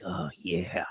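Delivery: low, hushed British snootiness; understated snooty British murmur. Acted. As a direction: drop it low and soft, mock-posh British. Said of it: "they'd be all snooty and British".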